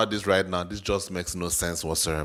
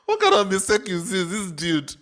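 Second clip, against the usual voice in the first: much higher and louder, laughing through it; incredulous laughing; 12 semitones higher, 5 dB louder than usual. Real, not acted. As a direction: cackle out the question in disbelief. Still laughing, incredulous at the clip.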